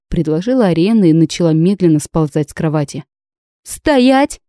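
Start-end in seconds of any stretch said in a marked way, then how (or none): 3.6-4.4 high, sharp command; barking an order. A narrator's reading. Narrator barks at the girl to stop. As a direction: bark the order. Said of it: "рявкнула я".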